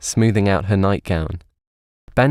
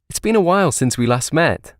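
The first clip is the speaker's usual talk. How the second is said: happy; 8 semitones higher than usual. A narrator's reading. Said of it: "she exclaimed happily".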